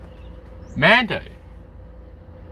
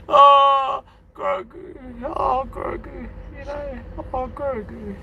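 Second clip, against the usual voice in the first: mock whimper; 9 semitones higher, slower than usual. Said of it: "that's how he felt".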